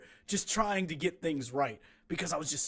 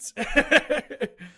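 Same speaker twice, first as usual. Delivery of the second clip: laugh; 10 semitones higher, 6 dB louder than usual. Real, not acted. Laughs about weight gain.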